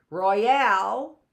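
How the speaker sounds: "Royale" with a loud, rising second syllable; second-syllable stress. Shown as a demonstration. Teacher showing the other stress, "Royale" stressed on the last syllable. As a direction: hit the end of "Royale", roy-AL. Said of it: "is the stress on the al".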